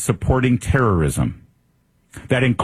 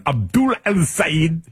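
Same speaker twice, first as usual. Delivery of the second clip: mock Arabic accent, drawn out and higher; showy newsreader's Arabic name; exaggerated Arabic pronunciation; 7 semitones higher, slower than usual. Shown as a demonstration. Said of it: "do the full correct pronunciation".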